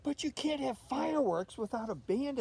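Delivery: put-on high, insistent little-girl voice; Olivia explaining. Olivia insists to her mother there must be a band, high and insistent. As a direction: lecture mom as if it's obvious. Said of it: "explained Olivia".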